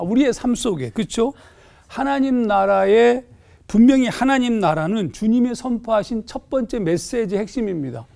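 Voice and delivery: ordinary talk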